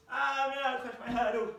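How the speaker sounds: high, loud wail of dismay; dismayed cry. Real, not acted. Teacher crushes her paper hurdle, loud high cry.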